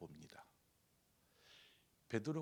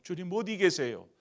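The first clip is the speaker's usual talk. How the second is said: loud, higher anguished plea; crying out; 8 semitones higher, 14 dB louder than usual. Pretend. Doubting believer calling on the Lord, loud plea. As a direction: cry out to the Lord.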